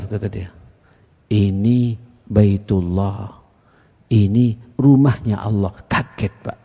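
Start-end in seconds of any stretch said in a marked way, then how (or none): 1.3-5.8 low, slow, patient correction; retold tender admonition; gentle explaining. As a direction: explain softly, word by word. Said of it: "Lembutnya Rasulullah".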